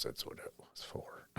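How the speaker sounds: whispered, knowing aside. Real, not acted. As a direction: whisper it like a secret. Snarky aside after the promotion.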